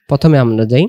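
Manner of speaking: usual speaking voice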